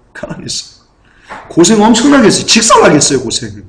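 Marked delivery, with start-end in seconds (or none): 0.1-0.7 unvoiced whisper of the same words; whispered repeat. Shown as a demonstration. Preacher to congregation, repeating in a whisper. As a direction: drop to a hushed whisper for emphasis.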